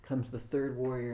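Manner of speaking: normal talking voice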